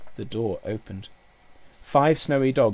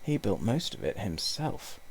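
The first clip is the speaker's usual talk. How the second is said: slightly hushed, confiding low voice; 2 semitones lower, 6 dB quieter than usual. A narrator's reading. Uncle confides about the Baron's model railway, low voice. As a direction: drop the volume a little, confidentially. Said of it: "said in a low voice".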